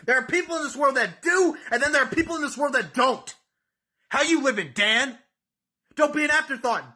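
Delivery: high, loud hype promo voice; wrestling promo. Acted. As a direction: cut a fired-up wrestling promo at the rival.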